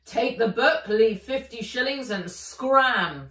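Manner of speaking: loud, firm dragon voice; a dragon laying down the law. Acted. Fizz insisting the elf keep her deal, loud and firm. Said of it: "Fizz said to Nat".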